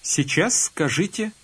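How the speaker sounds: ordinary delivery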